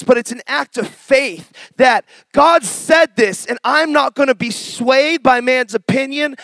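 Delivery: preaching with force. Acted. Preacher urging the congregation not to be swayed, forceful. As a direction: press the point home with conviction.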